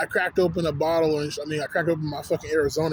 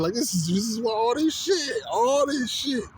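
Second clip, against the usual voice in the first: higher-pitched, fed-up venting; exasperated; 5 semitones higher, slower than usual. Real, not acted. Man ranting about why he avoids people, exasperated. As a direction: throw up the pitch in sheer frustration.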